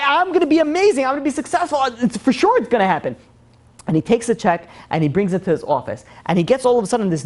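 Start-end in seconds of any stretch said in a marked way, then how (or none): 0.0-3.1 high-pitched, gushing self-belief; acted elation. Debtor convinced he'll be successful, elated. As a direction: imitate someone giddy with new confidence. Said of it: "he's in the next world".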